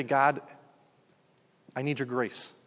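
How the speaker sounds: low, hushed prayer; humble plea to God. Acted. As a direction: softly admit your need to God, pausing.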